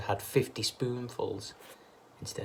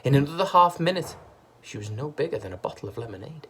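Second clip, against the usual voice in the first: ordinary delivery, nothing marked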